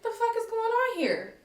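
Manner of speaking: high-pitched incredulous question; baffled indignation. Retold or imitated. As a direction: look around, confused and annoyed. Acts out her bewilderment.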